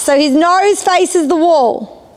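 normal talking voice

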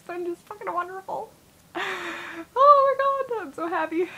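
real happy tears breaking through a laugh; crying with joy, voice going up high. Real, not acted. Vlogger overwhelmed telling viewers about her great week, crying.

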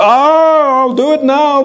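high, eager mock promise; lightly acted pleading Pharaoh; Pharaoh relenting. Pharaoh voiced giving in.

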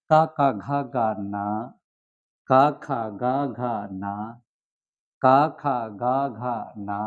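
কা, খা, গা, ঘা, না each with a clear open a vowel; Pali consonant drill. Shown as a demonstration. Teacher showing students Pali pronunciation, distinct open a vowel on each. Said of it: "উচ্চারণটা দেখাই এবার আপনাদের কি করে করতে হয়".

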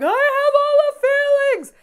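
high, loud and slow dramatic dog voice; dogs' voice. Retold or imitated. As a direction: ham up the overwhelmed dog. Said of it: "They're just like".

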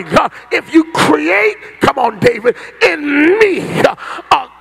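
shouting, high-pitched preacher crying out for fire; fiery preaching. Acted. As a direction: preach it full force, calling down fire.

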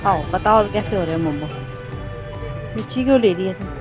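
ordinary delivery

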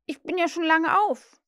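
put-on loud, high boy's voice; boy calling out. A narrator's reading. John insists he is already up, calling out. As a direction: pipe up loudly and high. John calls that he's awake.

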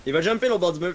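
ordinary talk, nothing marked

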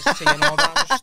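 high, loud laughing words; laugh-stretched high words. Real, not acted. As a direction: laugh while you tell it. Laughing mid story.

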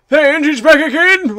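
high, loud cry of alarm; loud, high-pitched panic; panicked wail. A narrator's reading. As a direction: wail it out like a frightened intruder. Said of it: "wailed one".

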